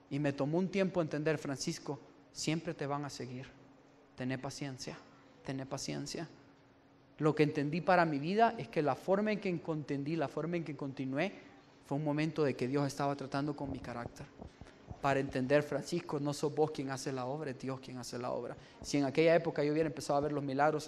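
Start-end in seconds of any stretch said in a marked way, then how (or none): 1.4-6.3 much softer, calm and reassuring; gentle voice of God. Retold or imitated. God reassuring the weeping Francisco, soft and calm. 15.6-18.5 much softer, calm and reassuring; gentle voice of God. Retold or imitated. God reassuring the weeping Francisco, soft and calm.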